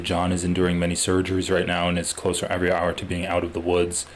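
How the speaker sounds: usual speaking voice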